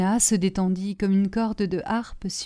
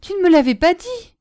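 high, loud cry of reproach; acted cry; 10 semitones higher, 7 dB louder than usual. A narrator's reading. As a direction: cry out accusingly at your lover. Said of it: "cria la Piémontaise à Léon". (cri de reproche, aigu et fort)

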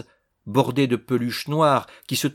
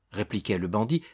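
ordinary delivery

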